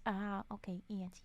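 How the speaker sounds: usual speaking voice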